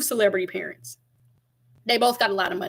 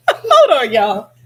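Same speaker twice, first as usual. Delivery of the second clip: laughing; 3 semitones higher, 9 dB louder, much slower than usual. Real, not acted. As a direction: break up laughing mid-line. Laughing through words.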